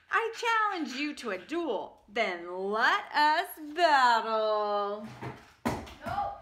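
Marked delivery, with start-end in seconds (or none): 0.1-5.1 pompous fruit knight voice; dueling apricot. Acted. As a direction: declare the duel grandly, stretching the end. Apricot challenges to duel.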